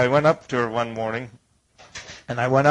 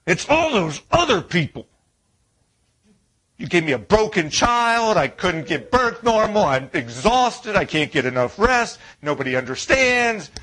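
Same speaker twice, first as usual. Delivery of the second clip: higher, louder whining victim voice; whiny complainer; 6 semitones higher, 3 dB louder than usual. Pretend. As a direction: play the self-pitying sufferer whining.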